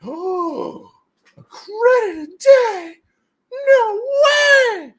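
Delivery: high, loud fake astonishment; mock amazement. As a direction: gasp like it's unbelievable.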